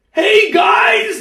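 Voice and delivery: high loud shout across the room; retold call-out. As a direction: shout it to get everyone's attention. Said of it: "and he says".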